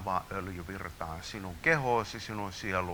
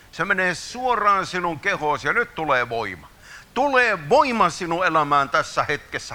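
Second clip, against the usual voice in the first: higher and louder, proclaiming power; fervent declaration; 7 semitones higher, 7 dB louder than usual. Real, not acted. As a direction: build to a proclaiming peak. Preaching peaks, declaring power. (kiihkeä, kovaääninen julistus)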